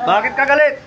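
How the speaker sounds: loud, stern questioning; forceful demand. Real, not acted. Healer questions the spirit's anger, forceful demand. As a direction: press hard for an answer.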